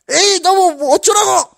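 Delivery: high, loud, drawn-out re-enacted yell; angry outburst. Preacher re-enacting kicking the restroom door, angry shout. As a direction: act out losing your temper, voice shooting up. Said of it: "화를 막".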